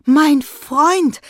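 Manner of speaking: high, loud joyful call; excited call. A narrator's reading. Farmer calls his friend over in excitement. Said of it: "rief er".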